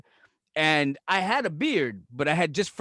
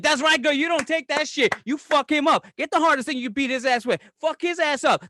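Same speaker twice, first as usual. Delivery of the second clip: put-on high-pitched cheerleading girlfriend; mock girl-power pep talk, high all through; egging her on; 7 semitones higher than usual. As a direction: hype her up like a sassy best friend. Voicing friend egging woman on.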